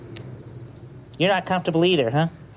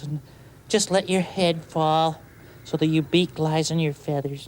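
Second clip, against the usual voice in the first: soothing bedtime relaxation; 3 semitones lower, slower than usual. Acted. Calm bedtime guide walking birds through relaxing. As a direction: lull the listener limb by limb.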